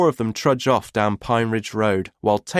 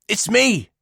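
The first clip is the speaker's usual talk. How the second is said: high eager call; 11 semitones higher, 3 dB louder than usual. A narrator's reading. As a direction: call out brightly. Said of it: "he calls".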